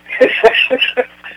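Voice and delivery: airy burst of laughter; breathy real laugh. Real, not acted. Host laughing about the book's secret, airy laugh.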